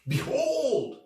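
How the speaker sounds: high, loud herald's cry; ringing royal announcement; royal proclamation. Acted. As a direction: proclaim the new king to the crowd. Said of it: "The king declares".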